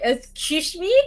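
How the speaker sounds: high, indignant Excuse me?; offended excuse me. Retold or imitated. Replaying her shocked reaction to a colleague's blunt verdict, indignant. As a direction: rise up in mock offense. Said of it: "And I was like".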